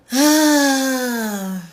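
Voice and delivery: loud frustrated cry of ah; exasperated loud ah. Real, not acted. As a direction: throw out a big exasperated ah. Exasperated outburst at a friend.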